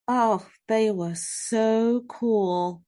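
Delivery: ordinary delivery, nothing marked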